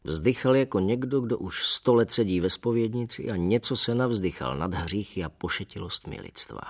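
usual speaking voice

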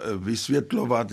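ordinary talk

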